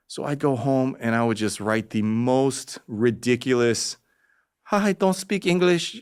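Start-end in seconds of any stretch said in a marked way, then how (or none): 4.6-5.9 mock broken English. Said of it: "this is the voice I hear in my head".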